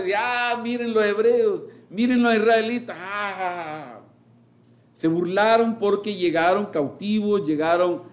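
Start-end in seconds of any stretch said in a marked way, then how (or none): 0.2-4.0 higher jeering taunt, lightly played; mock the captives like scornful nations. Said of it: "las otras naciones se burlaron". (burla de las naciones)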